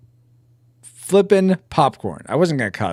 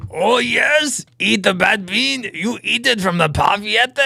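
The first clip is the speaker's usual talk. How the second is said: put-on high, hammy French-Spanish accent; higher, theatrical foreign accent; mock foreign accent; 7 semitones higher than usual. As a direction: urge them on in a muddled continental accent. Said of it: "saying Parfiette like it's Spanish".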